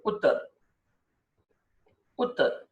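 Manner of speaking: ઉત્તર with a short clipped u; ઉત્તર, short quick first vowel. Shown as a demonstration. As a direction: say ઉત્તર fast, u kept short. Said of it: "બહુ જલ્દી".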